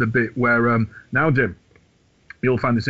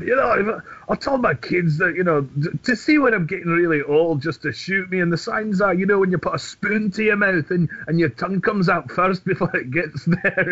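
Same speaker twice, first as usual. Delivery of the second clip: higher, lively Glaswegian Billy Connolly; Billy Connolly impression; 7 semitones higher than usual. Fan quoting a Billy Connolly stand-up bit, higher and lively. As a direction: tell the joke like Billy Connolly on stage. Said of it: "watched Billy Connolly stand up".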